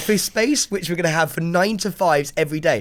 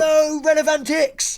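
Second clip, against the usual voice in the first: announcer greeting; 11 semitones higher than usual. Pretend. Greeting imagined fans.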